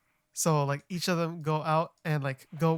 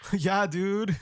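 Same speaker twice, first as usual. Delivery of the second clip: lightly put-on Californian dude talk; mock Californian accent; 3 semitones higher than usual. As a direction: play up the Californian accent a touch. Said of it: "for us as Californians".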